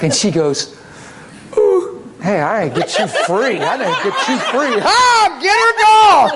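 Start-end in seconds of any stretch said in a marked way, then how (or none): 1.6-6.3 giggling, rising to a high laughing shout; laughing and shouting "get her, dog"; laughing outburst. Real, not acted. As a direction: let the joy spill over.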